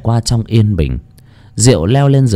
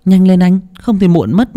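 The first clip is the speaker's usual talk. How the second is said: high, urging wake-up voice; wife calling husband; 10 semitones higher than usual. A narrator's reading. Wife hurrying husband so they aren't late, high urging call. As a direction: hurry a sleeper along in a high voice. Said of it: "Bà Ngọc gọi chồng".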